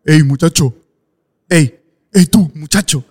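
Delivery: calling after someone across a parking lot; guard yelling. Retold or imitated. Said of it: "estaba escuchando al guardia gritarme".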